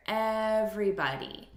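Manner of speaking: "everybody" with the first syllable EV punched; stress on EV. Shown as a demonstration. Teacher showing syllable stress to learners, first syllable punched. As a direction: hit EV in "everybody", rest light. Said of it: "look at the syllable stress here".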